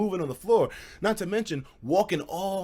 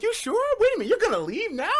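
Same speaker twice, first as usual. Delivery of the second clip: put-on high, fast disbelieving girl voice; lightly voiced girls asking; surprised girls. Girls surprised he is leaving, high fast.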